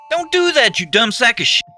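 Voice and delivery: high, loud furious yelling; aggressive roar. A narrator's reading. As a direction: roar it at him in fury. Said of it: "roared in perfect aggression".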